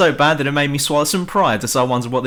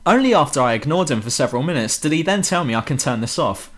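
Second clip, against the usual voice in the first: ordinary talk, nothing marked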